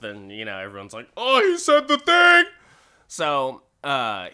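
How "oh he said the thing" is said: very high, loud mock squeal; excited audience. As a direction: squeal like a thrilled fan.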